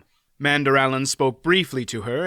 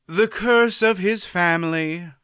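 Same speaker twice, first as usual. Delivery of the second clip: sighing; 6 semitones higher, 3 dB louder than usual. A narrator's reading. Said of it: "Aunt Pole sighed".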